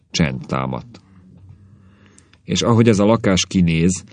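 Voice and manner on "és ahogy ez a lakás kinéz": plain reading voice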